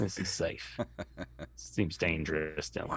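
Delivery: a low, secretive whisper about the danger down there; mock whisper. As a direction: whisper it as if you might be overheard. Jokey aside about the club backstage.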